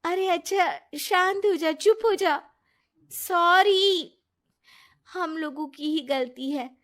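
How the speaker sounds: calm the crying friend down; comforting a crying friend, high and soothing. Acted. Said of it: "वो उसे शांत करती है".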